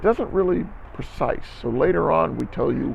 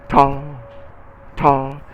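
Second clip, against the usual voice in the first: ta punched loud at the onset; accented ta; 6 dB louder than usual. Shown as a demonstration. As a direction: attack the front of each ta. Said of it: "Just emphasize the beginning of it".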